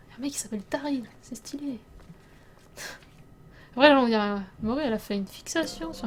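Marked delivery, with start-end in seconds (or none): 0.1-1.8 hushed, barely voiced aside; pretend confiding whisper. Streamer acting out telling her boyfriend about Daryl, whispered. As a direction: whisper it like juicy news.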